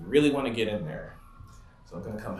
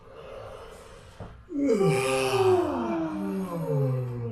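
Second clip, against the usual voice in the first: big drawn-out fake yawn; deliberate yawn. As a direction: stretch the mouth into a long yawn. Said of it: "We're gonna yawn on purpose".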